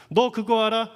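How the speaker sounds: retold mother's rebuke, loud and high; sharp retort. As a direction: fire it back as a scolding warning. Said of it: "다시 한번 쏘아붙였습니다".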